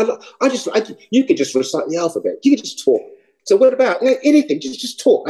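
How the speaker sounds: high-pitched fawning listener; smitten admirer. Retold or imitated. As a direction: plead in a high, charmed voice. Imitates admirers begging him to talk.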